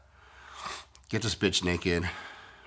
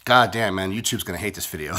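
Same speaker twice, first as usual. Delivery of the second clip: usual speaking voice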